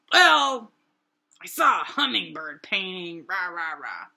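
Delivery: loud crabby outburst, hammed up; mock crabby. Reporting back to her friend, exaggerated crabbiness. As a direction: burst out, then grumble it away. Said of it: "crabby that I'm making this assumption".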